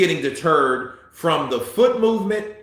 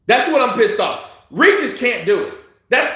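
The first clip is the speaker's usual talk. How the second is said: normal talking voice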